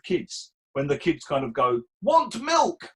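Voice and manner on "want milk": put-on high little-kid voice; lightly voiced child asking for milk; toddler asking. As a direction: ask it like a small child.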